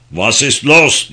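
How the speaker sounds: loud, drawn-out cry of alarm; alarmed scream. A narrator's reading. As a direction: cry out loudly, stretching the words. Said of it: "diye bir çığlık attı".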